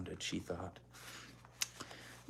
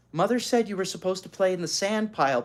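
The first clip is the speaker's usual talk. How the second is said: protesting; 6 semitones higher, 3 dB louder, faster than usual. Acted. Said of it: "protested Beezus".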